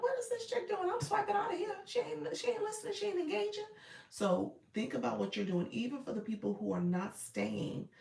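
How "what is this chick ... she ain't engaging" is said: high-pitched mimicry of a fed-up viewer; mock annoyed viewer. Viewer complaining about an ignored room, mock annoyed high voice. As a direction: put on a very high voice, griping like a viewer.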